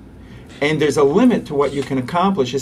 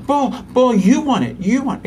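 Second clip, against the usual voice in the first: put-on higher, louder echoing the toddler's want; toddlerese; 10 semitones higher, 4 dB louder than usual. Shown as a demonstration. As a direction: mirror the child's feeling in short bursts. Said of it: "short phrases, repetition".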